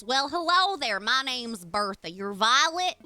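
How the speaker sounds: put-on chatty villager with a stretched accent; Bertha's folksy accent. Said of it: "she talks like this".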